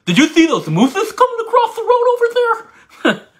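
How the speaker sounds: very high, lisping goofy voice, "mooses" for moose; high goofy lisp asking about "mooses". Pretend. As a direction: squeak it out like a lisping cartoon kid. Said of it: "You've got a lisp".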